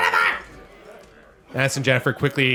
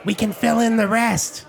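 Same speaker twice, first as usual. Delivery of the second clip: high, chirpy, fast-talking gnome innkeeper; Gilly the gnome; 14 semitones higher than usual. Acted. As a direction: gush over the heroes like a showman gnome. GM voices Gilly.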